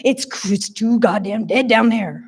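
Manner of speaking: exasperated Nova Scotian woman; thick Nova Scotian accent. A narrator's reading. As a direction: grumble it in broad Nova Scotian. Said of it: "in her thick Nova Scotian accent".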